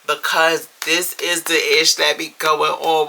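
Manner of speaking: ordinary talk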